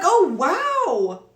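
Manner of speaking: high, loud acted astonishment; big retold wow; stunned reaction. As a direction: exclaim it like you can't believe it. Acts out reaction to found stuff.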